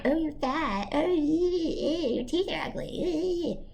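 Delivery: mocking, sneering inner critic; lightly voiced mean girl taunt. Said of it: "I'm not the voice, the [name] that is, like, mean to [name]".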